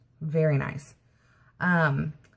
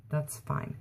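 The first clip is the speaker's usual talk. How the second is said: softer, unvoiced giving up; resigned whisper; 2 semitones lower, 10 dB quieter than usual. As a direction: breathe it out, defeated. Giving up on the call.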